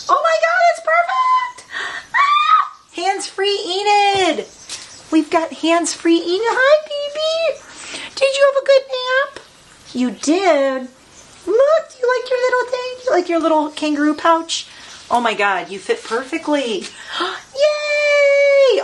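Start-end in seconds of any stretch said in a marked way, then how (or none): 0.1-2.7 very high, loud squeals of joy; delighted squeal. Real, not acted. Owner squealing as the pet fits the pocket. 3.0-14.7 very high cooing pet voice. Real, not acted. Owner cooing at Enid in her pocket, high baby talk. 17.2-18.8 very high loud yay; joyful cheer. Real, not acted. Owner cheering as Enid settles, high joyful yay.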